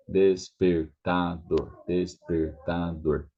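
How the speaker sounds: despertador slowly, joined up. Shown as a demonstration. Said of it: "then put out together".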